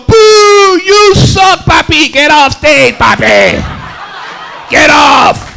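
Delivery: put-on high, loud heckle in a heavy Spanish accent; Jennifer's Spanish-accented heckling. Drunk woman to karaoke singer on stage, Spanish-accented heckling. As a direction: shout him off stage with a Venezuelan accent. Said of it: "She start heckling the guy".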